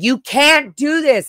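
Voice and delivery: high, slow, pleading protest; retold exasperated protest; upset protest. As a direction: push back, exhausted and upset. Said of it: "And I was like".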